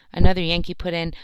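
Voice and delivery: ordinary delivery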